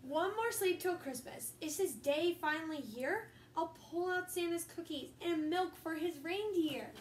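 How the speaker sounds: a steady read-aloud storybook voice; reading to children. Acted. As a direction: read it to the kids like a bedtime story. Reading picture book aloud.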